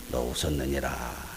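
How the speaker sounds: low, slow, solemn divine voice; solemn God. Acted. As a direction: pronounce it gravely like God knows all. God catching Sarah's lie. (낮고 느린 엄숙한 하나님 목소리)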